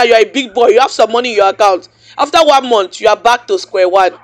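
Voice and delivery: ordinary talk, nothing marked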